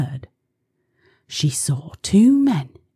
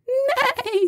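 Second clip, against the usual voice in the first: high neigh. Pretend. Horse sound imitated.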